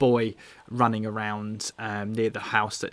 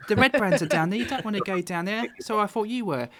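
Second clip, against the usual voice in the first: put-on high-pitched boy telling his adventure; boastful little lad; Carp, young boy; 6 semitones higher than usual. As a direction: blurt it out in an eager child's voice. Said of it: "And Carp pipes up".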